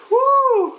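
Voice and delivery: high, loud excited woo; real, exhilarated fan whoop; hyped whoop. Real, not acted. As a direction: let out a long celebratory whoop. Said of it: "I was hyped up the entire time".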